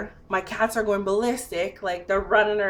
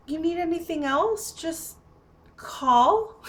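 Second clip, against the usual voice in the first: imitated hesitant offer in a higher voice; mimicked polite helper, high and halting; retold awkward offer; 9 semitones higher, slower than usual. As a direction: trail out an awkward offer, pitched up. Awkward visitor offering help.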